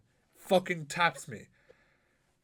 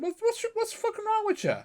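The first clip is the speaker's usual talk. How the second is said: exasperated outburst. Retold or imitated. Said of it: "I said".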